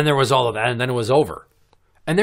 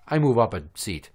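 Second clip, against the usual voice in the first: usual speaking voice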